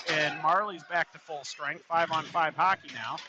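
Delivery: ordinary talk, nothing marked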